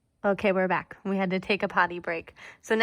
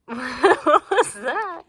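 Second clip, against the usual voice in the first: surprised; 7 semitones higher, 9 dB louder, much slower than usual. Real, not acted. Startled puppy, high slow laugh.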